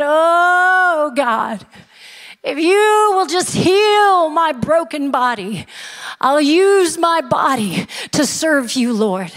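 high, drawn-out mock plea; hammed-up pleading with stretched, swooping vowels; crying out to God. A down-and-out man begs God for healing, hammed-up crying out. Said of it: "he's crying out to God".

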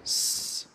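"س" as a sustained sss; S sound alone. Shown as a demonstration. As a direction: demonstrate "س" as just the S consonant, held without a vowel. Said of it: "اللي هو كده". (نطق "س" صوت سين ممدود لوحده)

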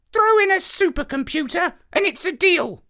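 high, loud squawking parrot. Acted. Parrot answering the president who betrayed him, high squawking voice. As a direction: squawk it out, cold and bitter.

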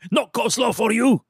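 high, clipped mock soup Nazi refusal. Mimicking the rude worker as the soup Nazi, high. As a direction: bark it like the Seinfeld soup Nazi. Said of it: "flashbacks of Seinfeld with the soup Nazi".

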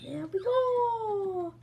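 slow, high sing-song; drawn-out victory chant. Acted. Triumphant drawn-out repeat.

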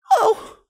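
high sharp gasp of shock; a sudden breathless cry; startled gasp. Acted. Narrator gasps at a shock.

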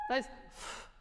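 da ist F whispered with no voice at all; unvoiced F demo. Shown as a demonstration. Teacher demonstrating no vocal fold closure, whispered. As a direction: breathe da ist F without closing the folds. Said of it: "kein Stimmbandschluss".

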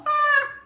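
sudden high, loud yelp of alarm; startled yelp. Real, not acted. Baker startled by the stove torch, yelps. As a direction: jump and yelp as the flame catches.

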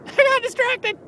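high squeak. Acted. Giggly apology, much higher.

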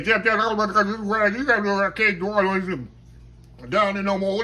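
mock a fast-talking Louisiana old-timer's accent; fast Cajun accent. Teasing impression of a hard-to-understand old friend, fast Cajun accent. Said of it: "he talk fast and got that Louisiana accent".

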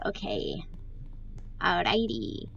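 breathy unvoiced okay; hushed okay; whisper. Real, not acted. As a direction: whisper it under your breath. Whispered aside.

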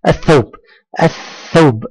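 الثوب with no L, straight into a doubled th; silent lam. Shown as a demonstration. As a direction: go from "a" directly to th in الثوب. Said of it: "لم تنطق اللام". (نطق الثوب بلام ساكتة وثاء مشددة)

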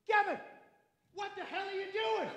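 high, strained hushed disbelief; shocked dad. Acted. Dad spots his son in a suit, high hushed disbelief. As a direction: squeeze it out high and soft, aghast. Dad's shocked double take, mantra.

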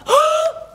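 sharp high gasp; shocked gasp. Acted. Thelma realizing it is still dark, shocked gasp. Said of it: "Thelma was shocked".

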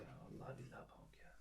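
breathless whisper of delight. Real, not acted. Vlogger whispering about the soft bread while Ezgi sleeps. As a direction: whisper it so nobody wakes.

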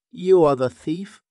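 high, loud accusing lion voice. Acted. Lion accuses the monkey of theft, loud and high. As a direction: point the finger as the lion. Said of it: "said the lion".

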